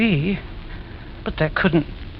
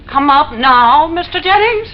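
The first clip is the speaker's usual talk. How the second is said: calling up; 7 semitones higher, 7 dB louder than usual. Acted. Calling up through the dumb waiter.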